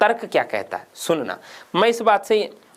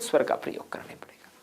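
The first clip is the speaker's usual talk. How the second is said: whisper; 5 semitones lower, 10 dB quieter than usual. Real, not acted. Teacher whispering the answer.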